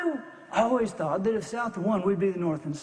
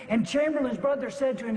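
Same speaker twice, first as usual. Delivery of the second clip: normal talking voice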